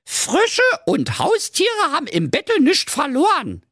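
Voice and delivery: very high, emphatic princess; indignant high-pitched scolding; Carola Knackwurst. Acted. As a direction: lay down the rule in a high diva voice. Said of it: "rief da die Carola".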